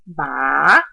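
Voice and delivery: "ba" low, dipping, then rising; third-tone "ba", a low scoop upward. Shown as a demonstration. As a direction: let "ba" sink and swing back up. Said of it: "third tone that starts low, dips".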